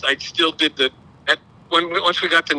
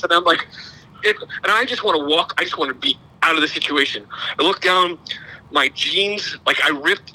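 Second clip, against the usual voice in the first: ordinary talk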